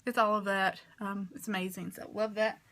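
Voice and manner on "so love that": usual speaking voice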